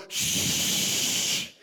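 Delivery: unvoiced shh; shush demo. Shown as a demonstration. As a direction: breathe out a long shush. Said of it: "you're gonna go".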